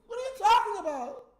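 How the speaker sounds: high, mostly loud playing-dumb voice; mocking a troll. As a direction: act clueless in a mock squeaky voice. Mocking trolls who missed stream.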